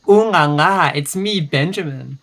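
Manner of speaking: showy, swooping loud opening; hammed-up flamboyant disguise voice; flamboyant impersonation. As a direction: proclaim it with flamboyant flourish. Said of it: "in a really flamboyant voice".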